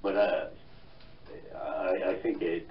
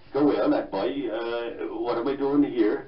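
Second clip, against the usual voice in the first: raised, loud Cork lilt; thick Cork accent; 5 semitones higher, 8 dB louder than usual. Shown as a demonstration. Guest mimics his boyhood self newly moved to London, thick Cork accent. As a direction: put on a thick Cork brogue, pitched up. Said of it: "Cork accent".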